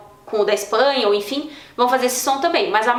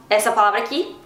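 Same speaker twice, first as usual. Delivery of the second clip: ordinary delivery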